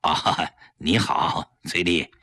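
low, slow, half-hearted greeting; reluctant low-pitched civility; forced friendliness. A narrator's reading. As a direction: force out a polite hello. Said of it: "强打笑脸， 和崔丽打招呼".

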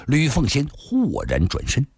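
ordinary delivery, nothing marked

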